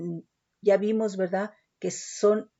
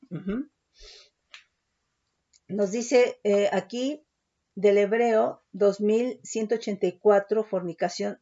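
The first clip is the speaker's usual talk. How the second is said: ordinary talk, nothing marked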